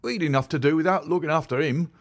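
a put-upon constable defending himself; sulky, hard-done-by policeman; aggrieved. Acted. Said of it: "in an aggrieved voice".

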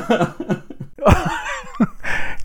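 a very high, breathy squeal of a laugh; high squeaky laugh. Real, not acted. Laughing at the dilemma.

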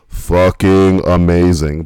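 loud, slow and drawn out; emphatic rave. Real, not acted. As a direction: lean hard into the praise. Raves about a burger place.

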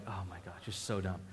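softly tossed-off teasing insult; muttered put-down. Retold or imitated. Restaurant owner teases the preacher for his ordering, muttered. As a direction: mutter it under your breath, fondly mocking. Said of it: "She's like".

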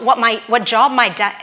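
normal talking voice

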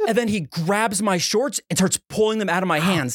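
ordinary talk

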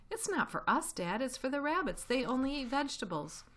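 high, eager little fox voice; a small cub explaining earnestly; small fox's voice. Acted. The smallest fox explaining to Dad, high eager child voice.

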